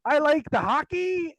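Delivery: eager hammed-up Canadian accent, slow at the end; mock Canadian teen proudly confirming, high and laughing; Canadian kid. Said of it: "And like".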